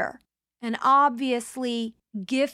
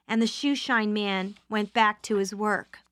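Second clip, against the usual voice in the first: ordinary delivery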